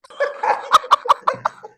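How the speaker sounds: loud, high, helpless laughing fit; burst of laughter. Real, not acted. Friend cracking up at the fart story, helpless laughter. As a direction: crack up loudly at a filthy punchline.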